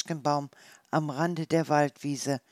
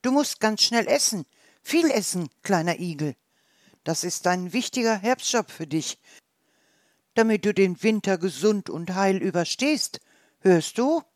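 higher, louder insistent advice; urging hedgehogs; 3 semitones higher, 5 dB louder than usual. Acted. The hedgehogs urge the little one to eat, higher and louder.

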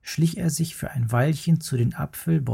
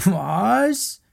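higher, disbelieving cub voice; incredulous little bear; 8 semitones higher than usual. Acted. Little bear can't believe the worm, higher and incredulous. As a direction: ask in amused disbelief.

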